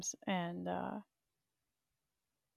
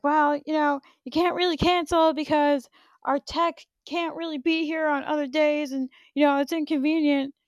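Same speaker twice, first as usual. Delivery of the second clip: higher and louder, a put-on irritated lady's voice; annoyed receptionist; 7 semitones higher, 9 dB louder than usual. Mimicking the pissed-off sleep clinic lady scolding her. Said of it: "the lady called me all pissed off".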